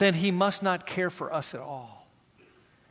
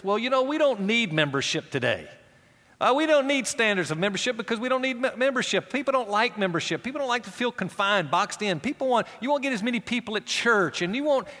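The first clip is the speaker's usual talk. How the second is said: mocking voice of a lax church leader; mock permissive pastor; 6 semitones higher than usual. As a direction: parody the easygoing leader brushing it off.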